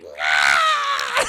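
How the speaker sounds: loud, hammed-up shriek; imitated tantrum scream. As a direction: scream it as Esther's meltdown.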